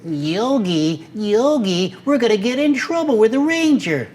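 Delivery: high, worried little sidekick bear; high anxious cub voice; Boo Boo. Retold or imitated. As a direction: fret like a small nervous cub. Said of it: "And his little friend".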